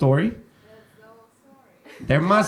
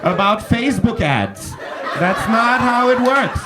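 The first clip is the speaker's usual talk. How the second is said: higher-pitched, mock-incredulous telling-off; exasperated retort; 6 semitones higher than usual. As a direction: scold him like a mate who's gone too far. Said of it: "I'm like".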